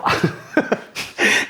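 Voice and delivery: high unvoiced laugh; burst of laughter. Real, not acted. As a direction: laugh out at the surprise. Laughs at recognising the Coop boss.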